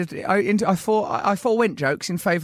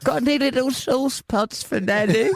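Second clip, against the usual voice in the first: mock timid request; 6 semitones higher than usual. Mocking friend asking a takeaway man for sauce pots, timid. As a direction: ask sweetly for sauce pots. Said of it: "he'd think I was a bloody surgeon".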